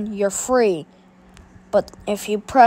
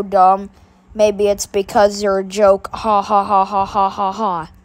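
mocking bully voice; 4 semitones lower than usual. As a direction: sneer at him like a schoolyard bully. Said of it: "having Rico come and badmouth you".

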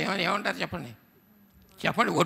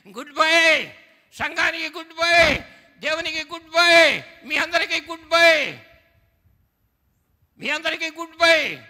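each goodbye rising into a high shout; loud goodbyes; 8 semitones higher, 8 dB louder than usual. Acted. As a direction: fling out every goodbye louder and higher. Pastor cries farewell to the church.

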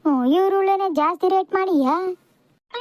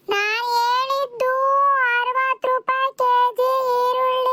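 high, drawn-out shout, word by word; stretched high-pitched yell to get the price across; exasperated yelling; 5 semitones higher, slower than usual. Acted. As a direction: shout it slowly for someone hard of hearing. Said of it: "ಅದ್ಯಾಕಂಗ್ ಕಿರ್ಚ್ಕಂತೀಯ".

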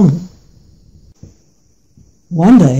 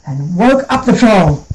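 plain reading voice

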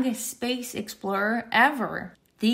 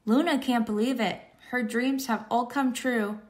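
usual speaking voice

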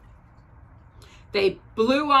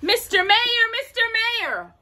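high-pitched alarmed hail; urgent calling; 13 semitones higher than usual. A narrator's reading. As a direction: call out to someone far away. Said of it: "Horton called".

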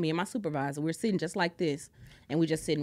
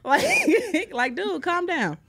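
laughing; 12 semitones higher, 8 dB louder, much slower than usual. Pretend. Mocking her own over-excitement.

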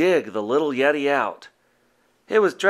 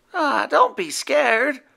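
higher, slow gentle reassurance; reassuring; 13 semitones higher than usual. A narrator's reading. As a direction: comfort the little Yeti. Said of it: "replied Howler, taking his hand".

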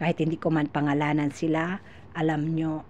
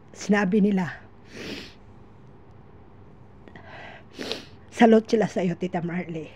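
emotional, voice full of tears; tearful; 3 semitones higher, 4 dB louder than usual. Real, not acted. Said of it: "naiyak ako".

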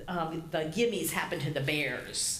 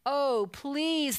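put-on higher-pitched childish plea; pleading shout; 6 semitones higher than usual. A narrator's reading. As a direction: beg Papa like a cub. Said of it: "they shouted".

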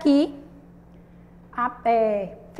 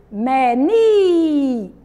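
Menina chanted slowly, climbing to a long loud ni, then falling on na; rise and fall on syllables; 6 dB louder, much slower than usual. Shown as a demonstration. Teacher showing where the voice climbs, loud sung-out Menina. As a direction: stretch the ni of Menina loudly, then drop. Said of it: "Você sobe e depois você desce".

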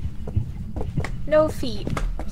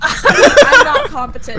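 laughing; 6 semitones higher, 8 dB louder, slower than usual. Real, not acted. Laughing self-mockery.

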